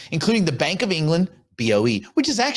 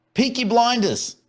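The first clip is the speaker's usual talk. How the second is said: higher mock British accent; 7 semitones higher than usual. Host prompting co-host, exaggerated British accent. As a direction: call it out in a put-on British accent.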